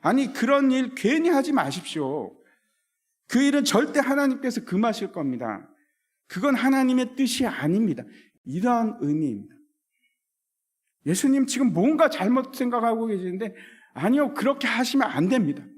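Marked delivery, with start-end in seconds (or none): 0.0-8.0 higher, urgent rebuke of a disciple; Peter's rebuke. Retold or imitated. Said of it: "더 직접적인 번역으로 표현하면". 11.0-15.6 higher, insistent scolding; Peter's scolding. Retold or imitated. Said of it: "꾸짖고 있는 겁니다".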